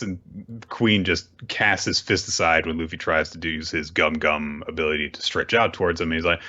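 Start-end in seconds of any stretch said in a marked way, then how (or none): none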